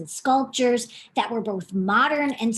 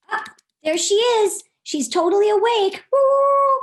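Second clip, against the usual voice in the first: excited; 9 semitones higher, 6 dB louder than usual. Acted. Waking duck, excited reveal.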